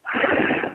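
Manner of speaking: short unvoiced dog growl; imitated growl. Shown as a demonstration. Trainer shows what the dog is hearing, imitated growl. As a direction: demonstrate a brief, throaty growl noise. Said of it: "he's hearing, ... he's hearing this growl".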